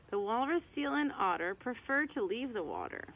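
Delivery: lively sing-song rhyme reading; reading to children. Acted. Reading a mammal picture book to kids, lively sing-song. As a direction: read the rhyming verse out to little kids.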